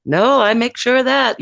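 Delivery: put-on high, firm old-lady denial; insistent grandma. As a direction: lightly mimic grandma insisting she returned everything. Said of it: "She goes".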